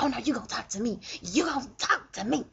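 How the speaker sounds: insistent demanding partner. Retold or imitated. Retelling a partner who insists on talking, mildly mock-demanding. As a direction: mimic someone refusing to let the talk drop. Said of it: "they're like".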